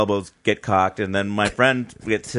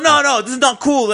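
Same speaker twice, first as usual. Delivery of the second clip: outraged protest; 6 dB louder than usual. Retold or imitated. Friend protesting to bouncers.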